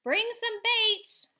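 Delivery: high, loud, slow yell; yelled reply. Acted. Sailors yelling up to the flying kids. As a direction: holler it up from the boat. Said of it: "they yelled".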